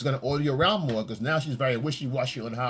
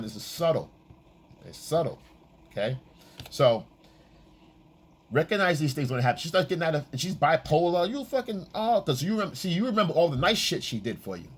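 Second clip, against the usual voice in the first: ordinary talk, nothing marked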